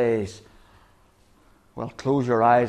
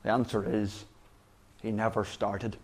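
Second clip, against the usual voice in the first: hushed punchline; 3 semitones lower, 10 dB quieter than usual. Acted. Preacher revealing the answer to the congregation, hushed. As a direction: drop to a near hush on the answer. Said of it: "sermon punchline dropped soft".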